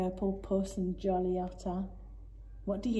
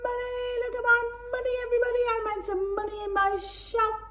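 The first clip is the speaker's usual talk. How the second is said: much higher singsong cheer; excited sing-song; 13 semitones higher, faster than usual. Acted. Dylan showing everyone his money, excited singsong. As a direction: crow it like a thrilled child. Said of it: "Dylan was very excited".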